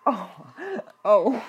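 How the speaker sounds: loud high laughing; burst of laughter. Real, not acted. Laughing hard at game.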